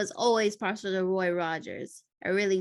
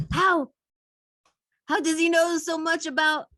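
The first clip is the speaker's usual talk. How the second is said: high, loud, alarmed disbelief; startled disbelief; 9 semitones higher, 5 dB louder than usual. Acted. Terrorist startled that McLean knows their names, high alarmed voice. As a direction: blurt it out, thrown off balance.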